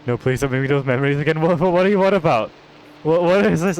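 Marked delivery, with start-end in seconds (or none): 1.4-3.8 surprised. Real, not acted. Stunned by item.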